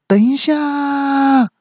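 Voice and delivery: high, drawn-out call to wait; a high, drawn-out cry to stop; shout to stop. Acted. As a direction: stretch it high and slow to halt the fight. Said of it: "大喊说".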